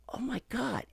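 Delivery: whispered, high-pitched gasp of recognition; breathy awe. Retold or imitated. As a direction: imitate her breathless, starstruck whisper. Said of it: "she gets all breathy with me".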